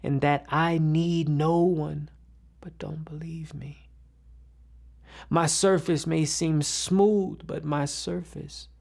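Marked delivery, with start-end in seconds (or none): 2.6-3.9 very soft, half-whispered aside; hushed confession. Acted. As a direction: drop to a near whisper and confide. Hushed aside undercutting confident claims.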